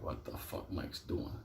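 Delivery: hushed whisper under the breath. Retold or imitated. To himself about Mike, hushed whisper. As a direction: whisper so nobody in the cell hears.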